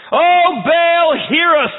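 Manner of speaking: high, loud, slow pleading wail; anguished high call to the heavens; desperate cry to Baal. Retold or imitated. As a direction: beg the god to hear you, drawn out. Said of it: "they say".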